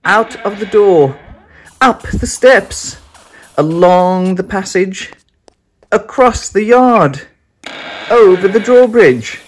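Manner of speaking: high, loud, urgent run-for-it chant; breathless escape. A narrator's reading. Narrator to listeners, racing out of the castle in a loud panic. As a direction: rattle off the escape route in a rush of fear.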